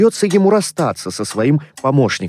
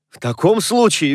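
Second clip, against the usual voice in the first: lightly merry, higher-pitched reply; cheerful; 7 semitones higher than usual. A narrator's reading. Nephew replying to grumpy uncle, cheerful. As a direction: answer back cheerily. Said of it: "весело отозвался племянник".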